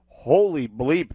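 ordinary talk

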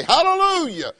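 high, ringing praise shout; shouted hallelujah. Shown as a demonstration. Preacher modelling for the congregation, high ringing hallelujah. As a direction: show them how to say it out. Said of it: "I'd say".

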